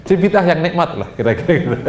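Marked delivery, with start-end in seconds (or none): 1.1-1.9 laughing. Real, not acted. Joking about enjoyable bid'ah.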